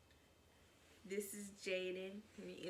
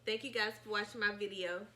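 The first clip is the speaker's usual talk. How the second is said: vlog outro; 3 semitones higher, 4 dB louder than usual. Acted. Channel outro.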